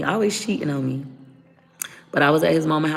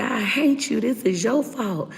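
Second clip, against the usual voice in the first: high, strained yelling at his father; angry accusation; 10 semitones higher than usual. Retold or imitated. Fighting with boyfriend, blaming his cheating father, high angry cry. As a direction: throw the blame at him, pitch climbing. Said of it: "I said".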